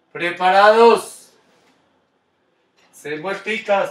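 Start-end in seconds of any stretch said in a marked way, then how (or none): 0.1-1.3 drawn-out call. Real, not acted. Coach readies kids to skate.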